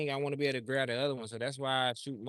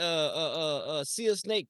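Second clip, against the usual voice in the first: normal talking voice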